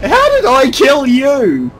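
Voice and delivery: high loud slow disbelief; incredulous. Real, not acted. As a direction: shout in amazed disbelief. Surprise kill.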